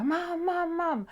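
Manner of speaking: lightly imitated boy calling mum from below. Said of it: "he was shouting up at the window".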